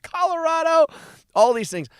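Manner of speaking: venting about tickets from another state; exasperated. Real, not acted. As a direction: throw Colorado up in outrage. Echoing the surprising state.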